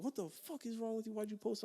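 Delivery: high, soft mock outrage; retold pissed-off comment. Commenters confronting him over the posted video, retold as pissed off. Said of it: "all of them were fucking pissed".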